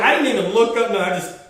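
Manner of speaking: normal talking voice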